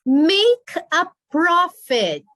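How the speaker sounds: very slow, high and loud; slow model reading. Shown as a demonstration. Teacher introduces the phrase slowly to the class. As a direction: stretch out Make a profit for the learners.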